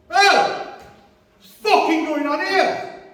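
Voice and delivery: very high-pitched, drawn-out challenge; high, mock-tough intervention; acted confrontation. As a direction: confront the troublemakers in a high, slow voice. Acted confrontation with brick throwers.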